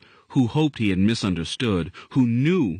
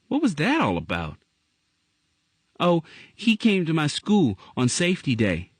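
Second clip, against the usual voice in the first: put-on child voice; 9 semitones higher than usual.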